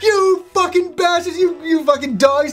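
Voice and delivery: high-pitched imitated angry outburst; mock angry yell. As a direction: play up the fury at the friends. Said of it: "fucking angry about it".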